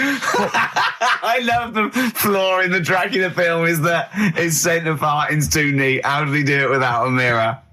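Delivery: words breaking through high laughter; can barely get it out for laughing, slow. Real, not acted. Laughing at Carl's Dracula flaw.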